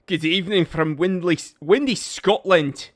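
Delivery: put-on a light Scottish accent on a viewer's greeting. Reading a Scottish viewer's greeting in a mild Scottish accent. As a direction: roll it out like a Scotsman. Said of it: "we gotta do the accent".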